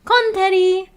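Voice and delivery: put-on peppy girly intro shout; Amiko's cheery greeting. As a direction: burst out a bubbly hello. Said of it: "She says".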